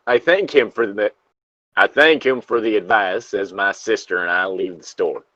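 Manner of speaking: usual speaking voice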